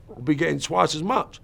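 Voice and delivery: usual speaking voice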